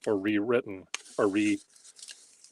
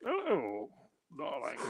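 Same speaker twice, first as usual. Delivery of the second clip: a hint of a dandy, higher and softer; lightly voiced suave dandy; 4 semitones higher, 8 dB quieter than usual. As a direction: purr a higher, softer, theatrical hello. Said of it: "I wonder how Noel Coward writes".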